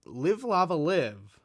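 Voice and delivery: ordinary talk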